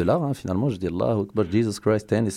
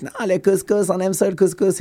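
mock capricious sisters; 8 semitones higher than usual. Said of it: "en mode caprice".

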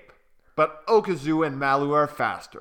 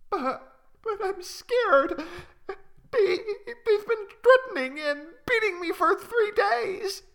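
scared little bird. Acted. Malu confessing her fear to Okazu, high and timid. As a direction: stammer it out like a frightened chick. Malu's voice, frightened.

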